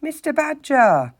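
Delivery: high, slow, important herald call; grand announcement. A narrator's reading. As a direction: announce him like a butler. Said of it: "announced with much importance".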